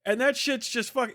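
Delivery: normal talking voice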